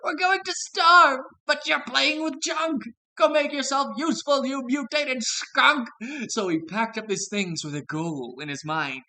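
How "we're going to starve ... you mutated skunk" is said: high, loud, furious goat crowd; angry goat shout. Acted. Goats scolding Billy for playing with junk, angry high shout. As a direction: yell at him in outrage. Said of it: "the other goats angrily cried".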